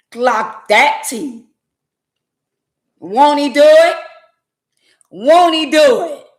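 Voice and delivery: high, loud church exclamation; loud joyful praise; praise shout. Real, not acted. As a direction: shout it like testimony. Praising God after fees waived.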